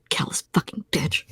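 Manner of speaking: muttering under breath. Acted. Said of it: "muttering under her breath".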